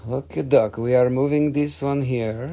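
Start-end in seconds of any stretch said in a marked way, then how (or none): none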